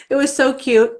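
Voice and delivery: ordinary delivery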